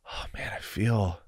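hushed, settling into the feeling; soft relieved sigh. Acted. Client feeling at home in their body, soft relief.

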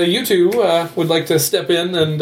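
usual speaking voice

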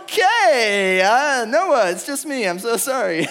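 loud, high mock panic, easing down; hammed-up yelled backpedalling; alarmed apology. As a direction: call out to reassure a scared brother. Acting out hurried apology to brother.

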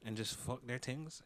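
ordinary talk

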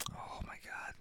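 whispered to herself while thinking. Real, not acted. Host whispers to herself hunting for an actress's name. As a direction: breathe it out, trying to remember.

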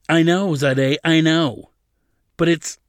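loud, high, cornered reply; exasperated. A narrator's reading. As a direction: cut in, frustrated, then break off.

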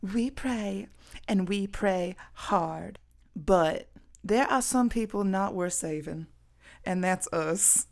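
slow mock-pious Southern; Southern accent. Signing off the video, slow and pious in a mock Southern accent. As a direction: pray it slow like a small-town church lady. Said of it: "let's do it in a country accent".

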